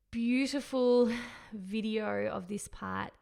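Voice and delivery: ordinary talk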